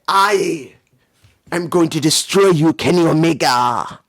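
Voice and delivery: loud, hammed-up wrestler's vow; too-loud acted threat. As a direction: belt out the threat like a wrestling promo. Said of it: "I'm sorry if that was too loud".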